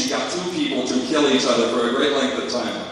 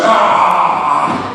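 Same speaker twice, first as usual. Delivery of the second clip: angry shout; 3 semitones higher, 6 dB louder than usual. Retold or imitated. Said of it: "he's pissed, he's going".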